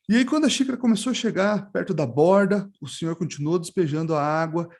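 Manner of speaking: usual speaking voice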